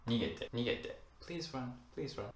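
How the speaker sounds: soft pleading please run; gentle plea. Acted. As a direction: beg them softly to run.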